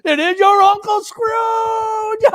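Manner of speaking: very high, slowing shout on the answer; triumphant cry. A narrator's reading. Wife's sister shouts the answer to Fred, very high and slowed. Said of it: "cried out".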